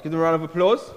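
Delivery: loud, high host call; calling for applause. Real, not acted. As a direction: pump up the crowd.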